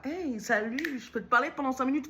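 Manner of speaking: high, quick canvasser pitch; imitated street canvasser. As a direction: hail a passer-by with rapid chirpy patter.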